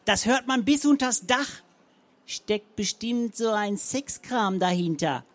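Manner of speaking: hint at something dirty; insinuating. A narrator's reading. Zenker insinuates.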